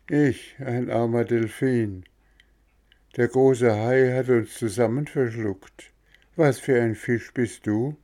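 put-on a resigned old dolphin answering the puppet; mild dolphin voice.

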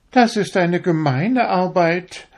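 high, proud little needle voice; a needle showing off how fine it is; vain darning needle. A narrator's reading. To the fingers, a vain needle in a high proud voice. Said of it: "sagte die Stopfnadel".

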